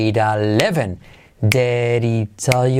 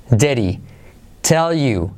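ordinary talk

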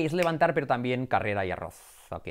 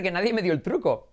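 ordinary talk

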